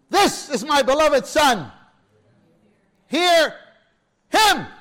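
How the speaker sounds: loud, slow, high proclamation from above; booming voice from heaven. Acted. The Father speaking from the cloud to the disciples, slow booming command. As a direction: speak as God thundering from the cloud.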